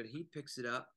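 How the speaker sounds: ordinary delivery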